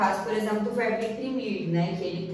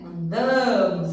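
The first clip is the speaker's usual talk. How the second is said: "Andamos" slow, DA louder; stress on DA; 3 semitones lower, 4 dB louder, slower than usual. Shown as a demonstration. Teacher repeating the word for students, stress on DA. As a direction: punch the DA in "Andamos". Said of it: "É esse A aqui que é o mais forte".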